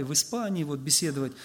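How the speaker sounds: ordinary delivery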